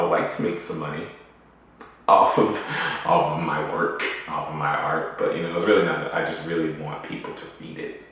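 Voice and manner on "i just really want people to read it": usual speaking voice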